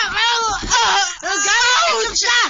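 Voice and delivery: high, loud crying shout; wailing yell mid-fight; pained shouting. Acted. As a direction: scream at her through the pain.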